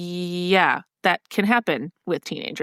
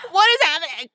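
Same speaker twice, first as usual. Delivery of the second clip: high, startled freak-out; mock freak-out; 8 semitones higher, 3 dB louder than usual. Imagining a boy suddenly in her room, mock freak-out. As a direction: play up a flustered panic.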